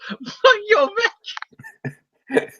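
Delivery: very high put-on villain squeal; Green Goblin. Goblin kicks Spider-Man in back, high mock villain voice. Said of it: "Green Goblin kicks Spider-Man in the back and goes".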